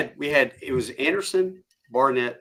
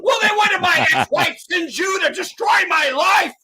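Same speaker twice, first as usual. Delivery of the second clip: very high, loud, wailing shout; mock hysterical sergeant tirade; 9 dB louder than usual. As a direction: build the rant to an absurd screaming climax. Acted sergeant's climactic tirade.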